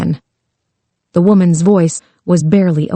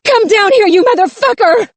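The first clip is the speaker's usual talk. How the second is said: very high raging yell; furious scream. A narrator's reading. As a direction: scream up the stairs to the captor. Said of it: "she screamed".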